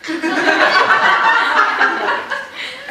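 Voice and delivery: breathy burst of real laughter; a real high cackle; high unvoiced laugh. Real, not acted. Laughs at the priest's joke.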